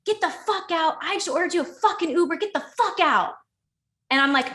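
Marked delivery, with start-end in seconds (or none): 0.0-3.4 high, urgent imitation of an angry yell; retold screaming order. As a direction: act out his furious demand to leave. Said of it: "screaming at me".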